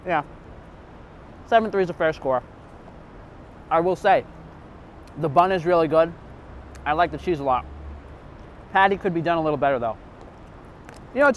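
talking between bites; muffled by food; mouth full, chewing. Real, not acted. Seen 1: Bites burger at -2, cheeks puffed and jaw chewing throughout.

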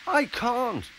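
high squeaky protest; very high, alarmed little voice; squeak. A narrator's reading. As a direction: squeak a refusal. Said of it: "squeaked Percy".

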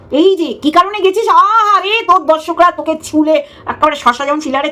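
sarcastic sympathy for the rival's hurt; mock pity. Real, not acted. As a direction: coo a fake "poor thing".

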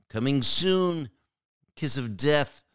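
coming soon blurted high and loud; a loud, high jokey curse on the movie; mock doom exclamation. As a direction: blurt it out as a jinx, both at once. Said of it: "We both did it".

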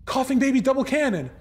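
high shrieking excitement; shrieking jackpot winner. Real, not acted. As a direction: squeal it out like you hit the jackpot. Streamer thrilled at rare loot.

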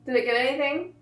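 peeling mask off mouth; speaking with lips pulled; lips stretched by face mask. Real, not acted.

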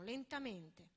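ordinary delivery

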